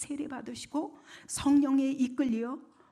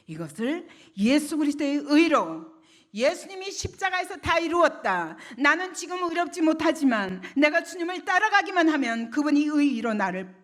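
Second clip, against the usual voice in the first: high, loud sermon proclamation; impassioned preaching; 6 semitones higher, 9 dB louder than usual. Real, not acted. Preacher declares Christ's finished work, impassioned and raised. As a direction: drive each clause home to the congregation.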